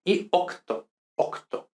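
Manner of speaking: octo with a crisp C then T; careful C T. Shown as a demonstration. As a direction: articulate octo, C and T both. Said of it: "mucho cuidado de no pronunciar".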